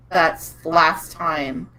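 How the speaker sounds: ordinary delivery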